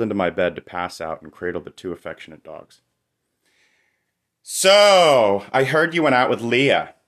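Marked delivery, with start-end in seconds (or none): 4.4-6.9 Leah hit hard and high, accusing; louder and higher, leaning on the name Leah like a charge; accusing emphasis on Leah. Acted. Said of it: "Sarah put extra emphasis on the name, as though it was an accusation".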